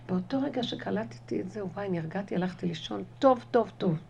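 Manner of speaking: normal talking voice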